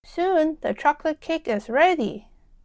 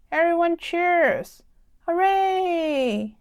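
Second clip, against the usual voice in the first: slow, higher prize-giving announcement; grand announcement; 4 semitones higher, slower than usual. Acted. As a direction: announce the winner with ceremony. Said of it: "she makes an announcement".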